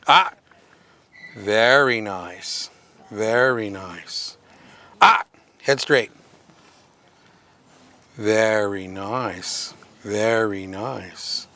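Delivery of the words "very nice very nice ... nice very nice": calm the dog with slow, low praise; soothing praise. Real, not acted.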